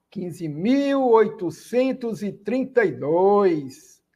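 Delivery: slow, careful number reading. Shown as a demonstration. Teacher reading a bigger number slowly to students. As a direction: read it slowly, word by word. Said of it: "que desafio".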